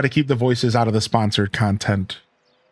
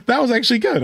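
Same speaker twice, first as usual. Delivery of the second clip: amused; 10 semitones higher, faster than usual. Real, not acted. Amused by the pun just told.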